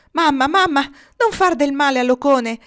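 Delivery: high, loud urgent plea; desperate shout. Acted. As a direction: burst in crying out to stop her. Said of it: "gridò Nils entrando come un uragano". (grido alto e disperato, implorando la madre)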